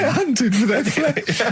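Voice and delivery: high-pitched, slow laughing squeal; slow, laughter-choked high voice. Real, not acted. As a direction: squeal it out through helpless laughter. Laughing at his own joke.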